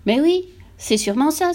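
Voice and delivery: high exclamation of sudden realisation. A narrator's reading. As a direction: exclaim as it dawns on you. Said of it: "s'exclama la mère". (exclamation aiguë, convaincue)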